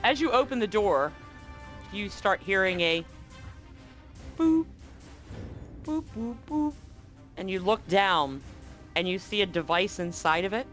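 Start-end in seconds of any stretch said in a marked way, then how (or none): none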